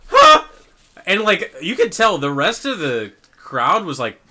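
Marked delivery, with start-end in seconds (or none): 0.1-0.4 loud high yelp; a startled yelp in a hushed theater. Retold or imitated. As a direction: let out a sudden loud yelp. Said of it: "this guy just fucking yelped".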